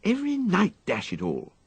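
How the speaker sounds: put-on a fed-up young master snapping at his valet; petulant upper-class complaint; overwrought young gentleman. A narrator's reading. Wooster's voice in story.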